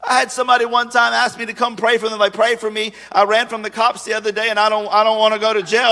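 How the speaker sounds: ordinary delivery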